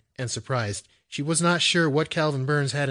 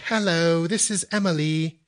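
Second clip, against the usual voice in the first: put-on British-accented hello, pitched up; 7 semitones higher than usual. A narrator's reading. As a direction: demonstrate her British phone greeting. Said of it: "It sounded like".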